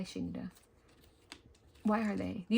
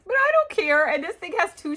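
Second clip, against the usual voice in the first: high, loud gleeful exclaim; excited; 9 semitones higher, 8 dB louder than usual. Real, not acted. Gushing over a friend's gift, loud and gleeful. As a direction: blurt it out with glee. Delighted by a used sticker book.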